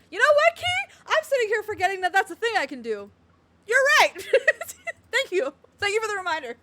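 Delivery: high, loud, animated outburst; loud excitement. Real, not acted. Streamer thanking a viewer for the reminder, loud and excited. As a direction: exclaim it loudly with laughter breaking in. Excited reply to her chat.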